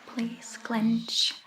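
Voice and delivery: ordinary talk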